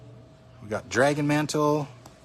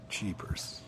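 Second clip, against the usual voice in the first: whispered; 3 semitones lower, 12 dB quieter than usual. Real, not acted. Quiet reaction to a pricey card.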